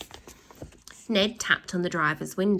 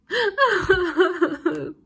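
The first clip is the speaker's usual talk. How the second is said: high, loud wailing sob; bursting into tears; 11 semitones higher, 6 dB louder than usual. A narrator's reading. A child breaks down crying over the stolen money. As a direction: howl out a flood of tears. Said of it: "bursting into floods of tears".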